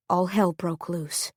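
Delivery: plain reading voice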